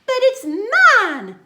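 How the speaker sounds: loud high scream, slowed; shrieking claim. A narrator's reading. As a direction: screech it with outrage. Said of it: "screamed the bird".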